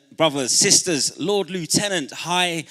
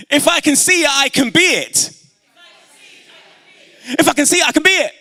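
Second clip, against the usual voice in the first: very high, loud chant; shouted affirmation; 12 semitones higher, 8 dB louder, faster than usual. Real, not acted. Speaker rousing audience with a motto, high rallying shout. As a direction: shout it out for the crowd to shout back. Leads crowd in call and response.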